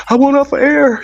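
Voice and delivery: high, whiny plea to get off air; mock a host begging to escape; mocking Jason. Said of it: "And Jason like".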